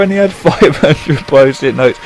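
words broken by a real laugh; cracking up at the punchline. Real, not acted. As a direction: giggle through five hundred Post-it notes. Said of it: "I can't tell this joke without laughing".

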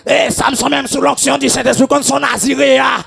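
shouted, driving sermon cadence; fiery preacher in full flow; full-force preaching. Acted. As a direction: preach it hard to the congregation. Preaching Samson's anointing.

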